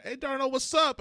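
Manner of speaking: hammed-up sing-song hey to the robber; cheery greeting. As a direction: imitate her chirpy greeting to a friend. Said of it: "I'm laughing about her being like".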